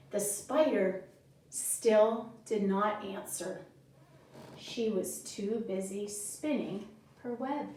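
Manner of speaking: quiet bedtime-story voice; story narration. Acted. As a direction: tell the spider's part gently to the kids. Reads story to children.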